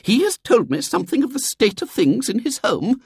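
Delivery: very high, indignant lady's voice; bursting out. A narrator's reading. Visitor accuses William's father, bursting out. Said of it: "burst out the visitor".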